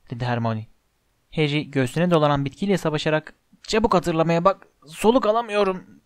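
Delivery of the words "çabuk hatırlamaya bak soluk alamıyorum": strained, higher toward the end; wheezing. A narrator's reading. Harry fighting the plant round his chest, wheezing. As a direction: wheeze it out while being squeezed. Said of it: "diye hırıldadı".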